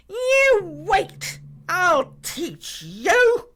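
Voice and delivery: high, very loud threat; furious shout. Acted. Villain threatening his sleeping guards, shouting furiously. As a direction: yell it, stretching the last word. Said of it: "he shouted at the guards".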